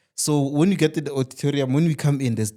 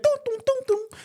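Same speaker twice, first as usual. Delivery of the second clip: card machine beeps. Retold or imitated.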